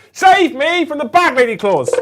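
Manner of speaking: loud, high, theatrical cry for help; mock desperate plea. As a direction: beg the dice at full volume. Mock plea over saving throws.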